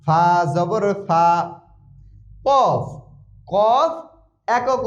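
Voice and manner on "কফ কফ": ordinary talk, nothing marked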